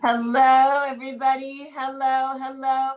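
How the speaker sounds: a big, loud hello to the room; loud greeting. Real, not acted. Host welcoming arrivals into the video room, calling out loudly. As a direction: call out hello as people join.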